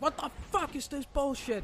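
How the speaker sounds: high, strained mock scream at normal volume; imitated scream. Player mimics people raging in the lobby, high mock scream. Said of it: "people just scream at you in the lobby".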